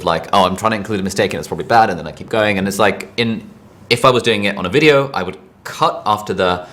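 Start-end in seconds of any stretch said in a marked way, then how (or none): 0.3-1.9 rattled off fast like a thought; mutter-quick self-commentary; quick inner aside. Retold or imitated. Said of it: "my thought process of".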